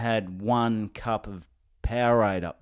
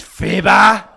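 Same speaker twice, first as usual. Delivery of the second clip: loud, slow coach calling out a player; demanding coach; 9 semitones higher, 10 dB louder, much slower than usual. Retold or imitated. Coach demanding answers from Fibber, loud stern call.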